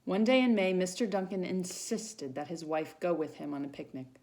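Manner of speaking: ordinary delivery, nothing marked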